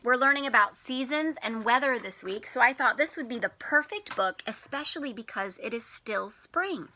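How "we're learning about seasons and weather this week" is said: normal talking voice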